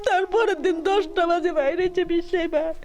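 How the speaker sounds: real grieving father sobbing through words; choked-up sobbing, voice breaking; snotty crying. Real, not acted. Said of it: "আবেগ আপ্লুত হয়ে পড়েন".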